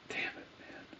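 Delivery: soft whispered disappointment; whispered curse. Real, not acted. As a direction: breathe it out in a whisper, gutted. Regretting a missed move.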